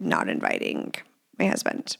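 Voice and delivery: whispered punchline. Real, not acted. Confessing to the listeners, whispered. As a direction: drop to a whisper for my husband.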